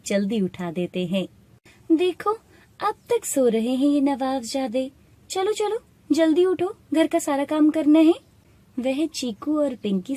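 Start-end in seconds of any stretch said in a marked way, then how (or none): 1.9-8.1 higher, pressing order to get moving; scolding wake-up call. Acted. Shopkeepers waking the children for chores, scolding. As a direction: rouse sleepers with impatient scolding.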